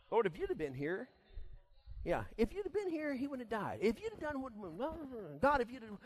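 high, bitter reproach; preacher mimics Martha's bitter complaint. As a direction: let the bitterness rise in her words. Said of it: "did we sense a little bit of bitterness".

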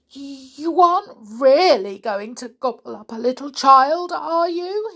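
high, loud, frightened disbelief; horrified monkey. Acted. Monkey pale and shaking.

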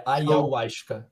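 "ayahuasca" drawn out slowly, the middle A clear; correcting pronunciation. Shown as a demonstration. Guest showing the host the missing A, slow careful pronunciation. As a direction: stretch "ayahuasca" to show the A after the Y. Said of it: "entre o Y e o U".

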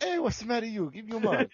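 put-on high, thick Italian mobster accent. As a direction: shake them down, Italian accent. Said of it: "You just sounded like my grandmother".

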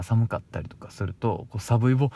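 ordinary talk, nothing marked

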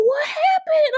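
high shocked cry, half laughing. Retold or imitated. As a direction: blurt it out in disbelief, up high. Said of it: "I was like".

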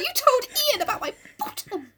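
very high, mortified dog voice; prim little lady. Acted. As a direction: squeak it in offended disbelief, half laughing. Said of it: "a prim and proper little lady".

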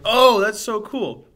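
slow, high, loud gushing awe; drawn-out wide-eyed wonder; amazed. Retold or imitated. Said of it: "And you'll be like".

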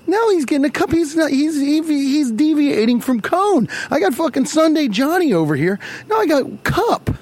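high, put-on whiny indignation; mock outraged server. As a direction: squeal it like an offended server.

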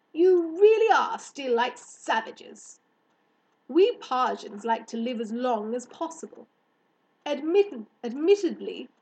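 loud, emphatic, superior hare; lofty hare. Acted. Hare explaining Parsian life to girl, superior. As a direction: lecture grandly as a visitor from elsewhere.

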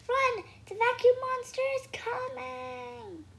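high, loud, drawn-out warning cry; shouted alarm. A narrator's reading. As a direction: yell it slowly and high to make them run.

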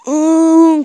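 high, loud eerie hum; monster call. Acted. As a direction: moan like an unseen creature.

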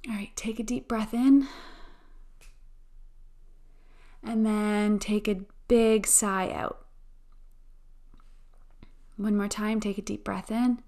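slow, calm affirmation voice; guided affirmations. Acted. Guided affirmation and breathing meditation.

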